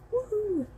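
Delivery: high-pitched "woohoo"; celebratory cheer. Real, not acted. Reviewer happy about a copy from NetGalley, gleeful cheer. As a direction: give a little high whoop of delight.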